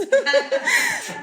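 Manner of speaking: a high, real burst of laughter. Real, not acted. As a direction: let a high laugh escape. Laughs at own joke.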